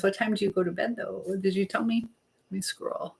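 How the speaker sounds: ordinary talk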